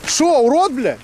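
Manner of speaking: sharply high, rising, outraged question; angry challenge. Real, not acted. Man answering after being called a freak, outraged. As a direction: fling the insult back as a challenge.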